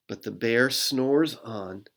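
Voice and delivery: usual speaking voice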